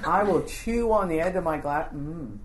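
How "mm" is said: low, soft "mm"; feigned attentive hum. Shown as a demonstration. Principal pretending to listen to a parent, low vague hum. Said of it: "Sounds will come out that sound like".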